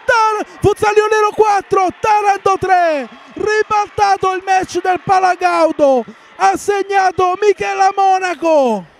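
screaming goal call, high and loud; breathless italian goal commentary. Real, not acted. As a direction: shout the goal at the top of your voice. Goal scored, commentator erupts.

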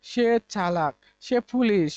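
ordinary talk, nothing marked

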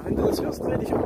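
hushed aside to the viewers; whispering. Real, not acted. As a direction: whisper so the man won't hear. Secretly talking about nearby man.

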